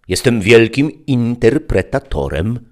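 high, slow, self-important announcement; grand interpreter. A narrator's reading. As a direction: puff himself up, slowly. Interpreter introduces himself grandly. (powoli, wysoko, z wyższością)

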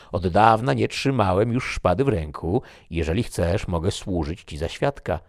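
bantering gentleman teasing his friend; Toledo, lively. A narrator's reading. Toledo voiced in narration. (lekko zagrany wesoły Toledo, wyżej i głośniej)

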